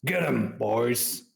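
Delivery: mild in-character yell; half-shouted battle order; yelled command. Acted. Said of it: "and yell".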